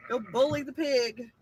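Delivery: ordinary talk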